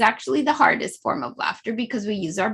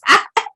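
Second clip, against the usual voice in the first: short high giggle; 8 semitones higher, 4 dB louder than usual. Real, not acted. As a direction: let a giggle slip out. Laughter meditation.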